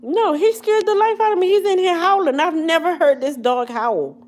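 high, loud, still rattled; excited and shaken. Real, not acted. Vlogger telling viewers the dog scared her, high loud excited. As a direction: tell it breathlessly, still startled.

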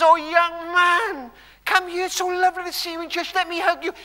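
a hint of a doting elderly churchgoer; gushing old lady greeting a young man; old lady's welcome. Preacher voices old church lady.

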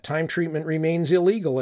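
ordinary delivery

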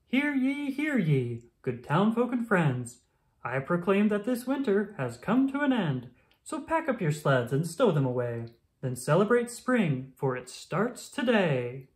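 high, grand announcing voice; town crier proclamation. Acted.

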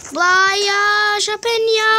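loud, high, slow call; drawn-out call. Real, not acted. Player calling out mid-game, loud drawn-out cry. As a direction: belt it out high and stretched.

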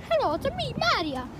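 high, sing-song video game hero greeting; Mario. Acted. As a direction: imitate a cartoon game plumber, jumping high then dropping. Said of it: "That's a good Mario impression".

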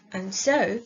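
ordinary delivery

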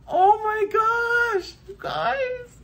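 high, slow thrilled squeal; excited squeal. Real, not acted. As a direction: squeal with real delight. Thrilled by gifts.

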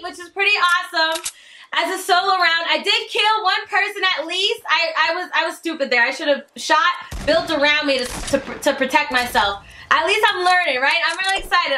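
ordinary delivery

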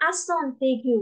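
"accent aigu" run together with T; linked pronunciation. Shown as a demonstration. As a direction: say "accent aigu" as ak-son-tay-gyu. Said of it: "အသံလေးဆက်လို့ရှိရင်တော့".

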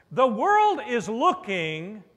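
usual speaking voice

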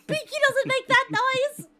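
high, loud and slow through laughter; laughing it off. Real, not acted. Streamer laughing off the scare, high and loud. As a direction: laugh while getting the words out.

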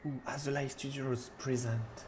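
slow put-on English accent. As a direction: announce it slowly in fake English. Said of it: "j'ai fait un accent anglais plus ou moins". (accent anglais lent et appuyé)

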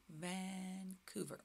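"Vancouver" slow and soft, Van-couver; slow modelling. Shown as a demonstration. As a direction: stretch "Van", pause, then "couver". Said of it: "Can you say Vancouver?".

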